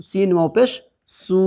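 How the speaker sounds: slow, drawn recitation of letters; spelling out syllables. Shown as a demonstration. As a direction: sound out each letter slowly like a Quran teacher. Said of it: "अब यहाँ पर क्या हो जाएगा".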